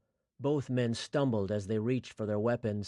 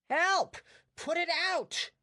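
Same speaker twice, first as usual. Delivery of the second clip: screaming in pain. Acted. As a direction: shriek for help while burning. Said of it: "he screamed".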